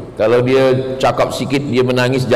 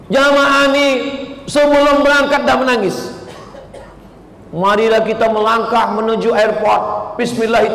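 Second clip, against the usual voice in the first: mock eager pilgrims; 8 semitones higher than usual.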